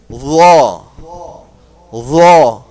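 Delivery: "Ra" loud and heavy, with a full mouth; full-mouth letter. Shown as a demonstration. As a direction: round out "Ra" as if blowing. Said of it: "pronounce it with full mouth".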